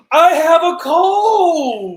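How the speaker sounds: high, loud, drawn-out delight; excited mock shout. Acting out a sick boy thrilled by his cold, excited. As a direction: crow it slowly like wonderful news. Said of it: "he's excited about having a cold".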